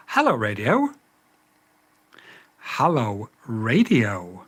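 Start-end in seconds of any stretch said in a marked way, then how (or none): none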